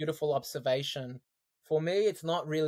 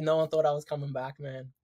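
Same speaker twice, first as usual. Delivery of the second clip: ordinary talk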